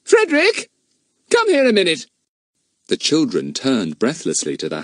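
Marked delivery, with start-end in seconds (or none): 0.0-2.1 loud call up to the house; raised voice. A narrator's reading. Mother calling her son out of the house, loud. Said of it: "She raised her voice".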